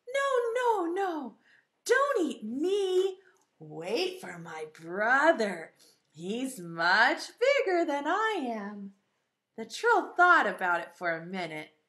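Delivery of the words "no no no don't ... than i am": pleading little goat. Acted. Little goat begging troll to wait, pleading. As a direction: beg the troll, stretching the words. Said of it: "the little billy goat said".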